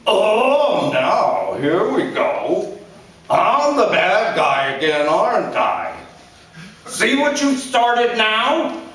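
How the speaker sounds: a blustering father scolding his son; angry father. A narrator's reading. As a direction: lecture him like a bitter dad. Father plays the victim.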